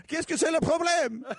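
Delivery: high laughing bad French accent; terrible French accent. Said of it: "there's a terrible French accent".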